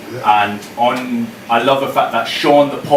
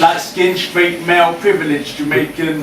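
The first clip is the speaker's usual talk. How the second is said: raised, ringing performance poem voice; higher and louder performance reading; spoken word poem; 6 semitones higher, 3 dB louder than usual. Acted. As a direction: deliver each line to the room like a slam poem.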